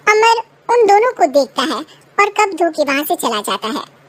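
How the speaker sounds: usual speaking voice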